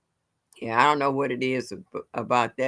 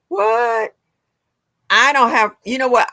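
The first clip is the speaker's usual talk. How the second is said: loud, high disbelief; raised-pitch, exasperated outburst; indignant surprise; 8 semitones higher, 8 dB louder than usual. Real, not acted. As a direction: burst out, taken aback. Reacting to a complaint about notifications.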